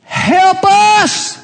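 high, loud desperate cry. Retold or imitated. Mother crying out to Jesus, loud and high. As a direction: cry out to him with everything.

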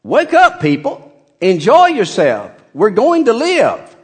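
high and loud wake-up shout; Paul shaking the despairing crew awake, loud; rousing call. Retold or imitated. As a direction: rouse the sleepy crowd, loud and high. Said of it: "He's just saying".

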